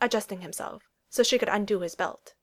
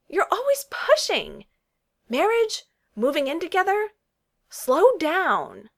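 angry outburst; 9 semitones higher, 6 dB louder, slower than usual. Acted. Lizzie lashes out at Darcy.

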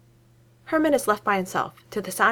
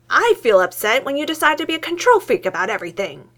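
louder and higher, getting heated; voice rising; 6 semitones higher, 6 dB louder than usual. A narrator's reading. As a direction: raise your voice as the anger climbs. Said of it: "his voice rising".

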